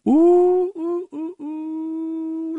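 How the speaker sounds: high, long drawn-out "ooh"; mock siren wail. Host hyping the Arizona audit to listeners, high wailing ooh.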